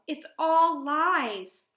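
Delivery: high, loud, very drawn-out wail; anguished, stretched accusation; sobbing outcry. Acted. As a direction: wail it out through distress. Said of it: "I sob".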